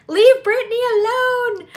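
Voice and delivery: high, loud, slow tearful plea; mock-sobbing fan, high and loud. Meme quote about [name].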